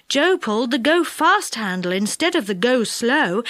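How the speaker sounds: ordinary delivery, nothing marked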